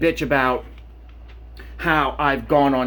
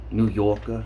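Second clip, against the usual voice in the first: a rough stab at a New York accent; attempted New York accent; 6 semitones lower than usual. Shown as a demonstration. Vlogger mocking how New Yorkers talk, in a shaky New York accent attempt. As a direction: try a New York accent, not quite landing it. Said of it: "I can't even do that accent".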